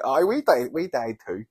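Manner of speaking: high, loud boastful chiming-in; hammed-up, eager me-too voice; mock Corinthians. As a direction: pipe up loudly to join the claim. Mocking the Corinthians' claim, acted.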